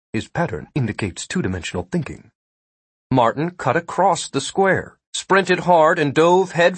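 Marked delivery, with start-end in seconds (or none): 0.1-2.3 lightly voiced, quiet and quick observation; understated, softer remembered film line, delivered quickly; Spock. A narrator's reading. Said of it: "Martin clearly heard Spock saying".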